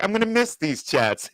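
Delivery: high sweet farewell voice; mock press secretary. Retelling a goodbye between the press secretary and Ducey, a sweet high voice. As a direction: say it sweetly, sentimental. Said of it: "she's like".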